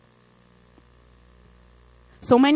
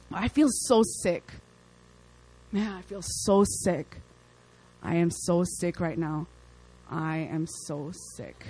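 mock sick moan; 5 semitones lower, 9 dB quieter, slower than usual. Mimics a weighed-down complainer.

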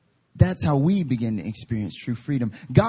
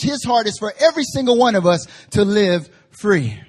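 emphatic, driving pulpit voice; impassioned sermon exhortation; forceful preaching; 6 semitones higher, 4 dB louder than usual. Real, not acted. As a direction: drive it home to the congregation.

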